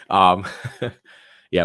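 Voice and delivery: raspy, strained voice; laughing through a sore throat; hoarse voice. Real, not acted. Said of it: "my voice is super jacked".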